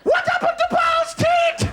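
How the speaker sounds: very high, slow mum shriek; hammed-up mum in falsetto; alarmed Jamaican mum. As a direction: screech the question like a horrified mother. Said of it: "but she was like".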